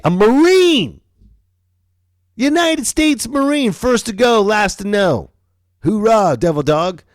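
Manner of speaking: very high, loud mocking chant; mocking Marines. Roasting the Marine's gung-ho slogans, very high and loud. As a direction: ridicule their motto.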